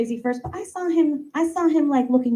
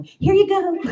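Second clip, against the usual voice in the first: playful call; 11 semitones higher, 5 dB louder than usual. Pretend. Throwing ball to sister.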